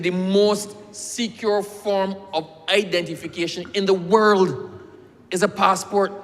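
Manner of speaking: high, loud, drawn-out lecture to a clerk; hot-headed retort. Retold or imitated. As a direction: hammer the point home angrily. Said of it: "my head used to be hot".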